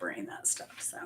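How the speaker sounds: sudden whispered aside; hushed confiding whisper. Real, not acted. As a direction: drop to a breathy whisper. Aside while talking to camera.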